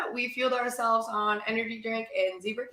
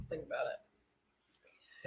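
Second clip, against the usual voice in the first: whispering; 7 semitones lower, 8 dB quieter, much slower than usual. Real, not acted. Whispered aside.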